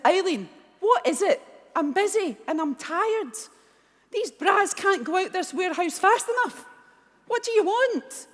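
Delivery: high-pitched, exasperated retelling of a phone rant; irritated retort. Retold or imitated. Busy, tired founder to friend she thinks is pranking her, exasperated. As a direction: act out being fed up, pitch climbing toward the end.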